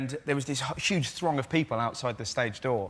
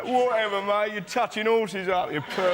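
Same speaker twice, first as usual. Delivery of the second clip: higher, mock heckling London retort; swaggering Londoner; 7 semitones higher than usual. Londoner answering the actor at stage door, raised mock heckle. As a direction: imitate a cocky Londoner dismissing the actor.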